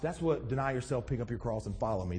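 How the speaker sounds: ordinary talk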